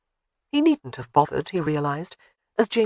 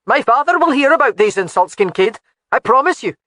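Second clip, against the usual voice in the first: higher, louder threatening vow; angry threat; 7 semitones higher, 7 dB louder than usual. Acted. Laird's son threatening Kincaid with his father, angry and raised.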